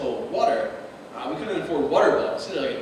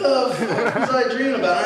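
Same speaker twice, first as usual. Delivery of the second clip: higher, laughing disbelief; baffled mock outburst; 7 semitones higher, 3 dB louder than usual. Waking with thumb in his ass, laughing disbelief. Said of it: "And I was like".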